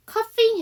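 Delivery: "coughing" with the gh sounded as F. Shown as a demonstration. Teacher explains gh to learners, "coughing" said with F. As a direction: say "coughing" with a clear F in the middle. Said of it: "F 발음이기 때문에".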